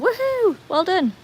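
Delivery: high-pitched whoop of congratulations; celebratory cheer. Real, not acted. Streamer congratulating viewer on levelling up, celebratory cheer. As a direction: whoop high, then praise brightly.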